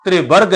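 ਤ੍ਰਿਵਰਗ as "tri-barg", t running into r; ਤ੍ਰਿਵਰਗ starting with a joined tr; vowel on the r. Shown as a demonstration. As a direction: pronounce ਤ੍ਰਿਵਰਗ with the short i after the r. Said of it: "ਸਿਹਾਰੀ ਪੈਰ ਵਿੱਚ ਪਏ ਹੋਏ ਅੱਧੇ ਰਾਰੇ ਨੂੰ ਲੱਗੇਗੀ".

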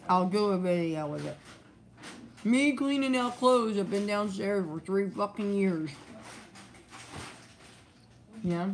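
chewing while talking; talking around a bite; mouth full. Real, not acted. Seen 1: bit food at -3; lips pursed, cheek bulging mid-speech.

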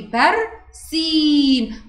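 برسيم with the long i held very slowly; teacher draws out the سي of برسيم; stretched demo. Shown as a demonstration. Teacher demonstrates madd to pupil, stretched vowel. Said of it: "بصي كده وأنا بقولها".

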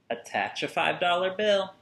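usual speaking voice

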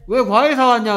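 high, loud mock telling-off; mock scolding. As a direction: act out a parent's loud scolding. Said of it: "하면서 혼냅니까?". (목소리 높여 혼내는 엄마 흉내)

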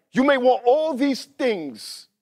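high, loud and drawn-out sermon warning; impassioned preaching. Real, not acted. Preacher warning the congregation about worldly wants, impassioned preaching. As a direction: stretch the warning out at full force.

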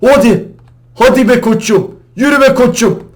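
loud high pep cheer; cheering himself on before the jump; hyping himself up. Real, not acted. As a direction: pump yourself up out loud. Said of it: "Kendimi gaza getiriyorum".

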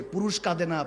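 normal talking voice

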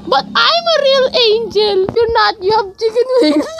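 high, squeaky voice insisting, rising to a shout; a petty character claiming to be the real angel. Acted. Two angels bickering over who is real, in a squeaky voice.

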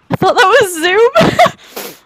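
high, loud, slow disbelieving cry; surprised. Real, not acted. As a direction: exclaim in shocked disbelief. Surprised at game mishap.

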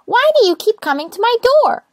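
high, loud and cross; annoyed little rosebud. Acted. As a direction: complain about the racket, high. Rosebud exasperated.

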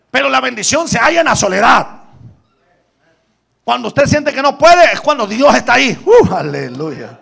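loud, high fervent sermon delivery; full-force preaching. Acted. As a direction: preach it out to the congregation. (predicación a todo pulmón)

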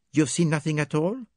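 put-on French accent, smug and precise; Poirot presenting his witnesses in a French accent. A narrator's reading. Voices Poirot.

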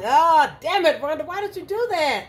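loud, high startled yelp; startled outburst. Real, not acted. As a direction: jump at the noise and complain loudly. Startled by own loud fart.

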